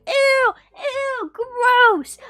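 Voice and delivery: loud, high, drawn-out cries of disgust; disgusted shrieks. Retold or imitated. New friends first seeing her roaches, shrieking in disgust. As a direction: recoil from the bugs with a loud squeal.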